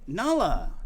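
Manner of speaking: loud scolding call to a pet. Real, not acted. Owner calling to the cat mid-reading, loud call. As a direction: raise your voice sharply on the name.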